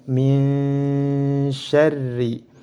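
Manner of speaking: very slow "Min syarri", n blurred into a long nasal hum; chanted "Min syarri" with a held, hidden n; veiled nun. Shown as a demonstration. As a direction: hum through the n of "Min syarri" without closing it. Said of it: "Dan ditahan dua".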